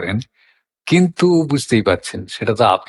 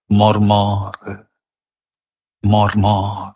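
মর্মর low and evenly drawn out; soft low glide; 5 semitones lower, much slower than usual. Shown as a demonstration. Reciter showing wind through leaves, soft gliding মর্মর. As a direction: let মর্মর roll gently in a low register, no stress. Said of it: "মৃদু করে কোমল পর্দা লাগিয়ে বলছি".

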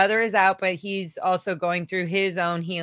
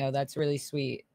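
normal talking voice

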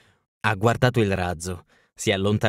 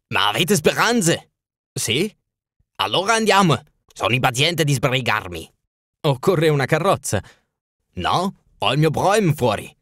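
higher, eager royal voice; impatient king; 4 semitones higher than usual. Acted. King urging Holmes to leave at once, eager and higher. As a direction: push him to hurry, like a restless monarch.

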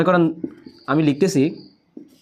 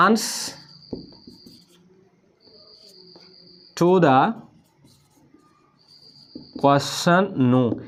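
word by word with very long pauses; writing-out pace; much slower than usual. Shown as a demonstration. Teacher writing an exam heading for students, slow dictation pace. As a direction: spell it out slowly as if writing. Writing the phrase out while speaking.

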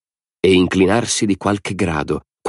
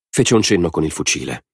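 plain reading voice

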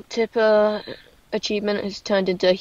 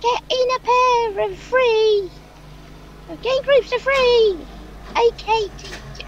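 bossy teacher; 13 semitones higher than usual. Pretend. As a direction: order the class into groups. Teacher ordering cows into groups.

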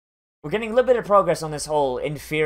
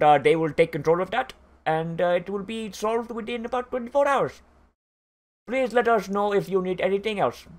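higher-pitched mock Indian call-centre voice; Indian accent; 3 semitones higher than usual. Same secretary answering a pest control request, hammed-up Indian accent. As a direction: play the helpline clerk with a put-on Indian accent. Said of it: "that same guy is like".

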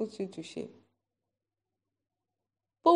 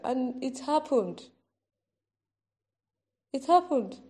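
ordinary delivery

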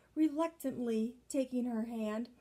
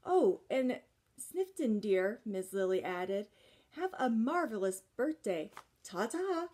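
cooing farewell. Acted. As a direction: trill a dainty ta-ta on the way out. Miss Lily's farewell.

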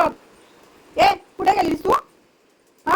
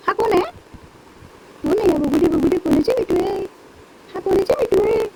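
high, singsong cooing to a parrot; pet talk; 6 semitones higher, 4 dB louder than usual. Real, not acted. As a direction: tease the pet with lilting, raised questions. (पोपटाशी लाडिक, उंच आवाजात बोलणे)

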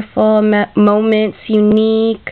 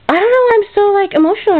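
near tears; 8 semitones higher, much faster than usual. Real, not acted. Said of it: "I'm gonna cry".

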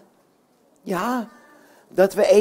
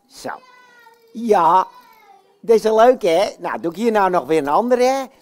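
Jan Klaassen puppet voice; 3 dB louder than usual. Acted. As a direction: play the boastful puppet hero for kids. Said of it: "ik ben namelijk de enige, de echte Jan Klaassen".

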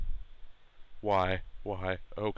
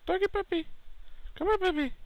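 loud, very high squeaky falsetto; high doggy-talk; 3 dB louder, faster than usual. Acted. Player calling the dog over, loud squeaky falsetto. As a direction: call a dog in sky-high baby talk.

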